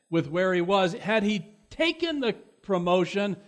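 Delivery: loud, high, emphatic preaching voice; forceful preaching. Real, not acted. Preacher telling of a friend's refused promotion, forceful preaching.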